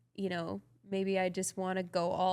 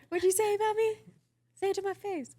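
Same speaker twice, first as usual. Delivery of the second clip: high squeaky pet voice; dog voice; 13 semitones higher, 5 dB louder, faster than usual. Pretend. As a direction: voice the dog picking a fight. Voicing the baby dog.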